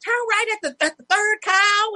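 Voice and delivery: high, mocking country directions; imitating a neighbor giving directions by cows. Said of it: "you're like".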